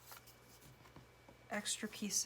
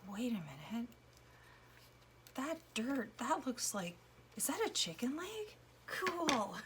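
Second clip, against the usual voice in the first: surprised discovery; 5 semitones higher than usual. Pretend. Imagined viewer spotting hidden chicken.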